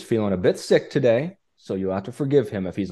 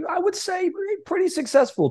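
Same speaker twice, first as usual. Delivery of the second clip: high pitched. Acted. Hamming up praise of guest.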